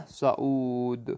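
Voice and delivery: teacher closing the spelling of صعود; soft slow model word. Shown as a demonstration. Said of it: "صاد عین واو دال".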